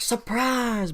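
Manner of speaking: surprise shouted up high in a mock ambush; playful high ambush cry. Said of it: "colonists come out of woodworks going like".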